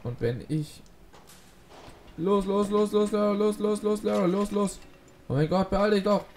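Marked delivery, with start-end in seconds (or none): 2.2-4.8 high, loud urgent chant; urging on. Real, not acted. Urging character to hurry. 5.3-6.2 high, loud impatient plea; impatient urging. Real, not acted. Urging character to hurry.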